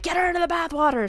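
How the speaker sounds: high, hurried psychic lady voice; urgent high-pitched order. Retold or imitated. Psychic lady ordering parents to the bathwater, high and hurried. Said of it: "the little psychic lady's like".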